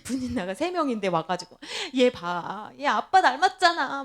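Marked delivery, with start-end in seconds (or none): 1.9-4.0 mock showing-off, getting louder; bragging. Rival mother showing off her child to Hannah, mock bragging. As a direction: imitate a proud parent's boast. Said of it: "막 자랑한다 그러면".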